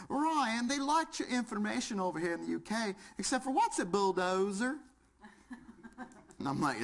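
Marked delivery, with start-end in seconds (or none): 0.1-4.8 higher British lady on the phone, much higher on her name; British accent. Retold or imitated. Imitating the London Fire Brigade caller in a British accent. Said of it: "I love her accent".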